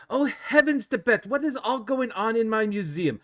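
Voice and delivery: high, loud, prim noblewoman gasp; posh elf lady. Acted. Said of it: "it's like".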